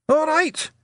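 very high, loud Scots yell; piercingly high little brawler; tiny fighter's shout. A narrator's reading. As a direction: shout it as a pugnacious challenge. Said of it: "shouted the tiny fighter".

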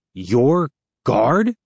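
high, loud disbelief; surprised. A narrator's reading. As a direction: blurt it in shock. Shocked realization question.

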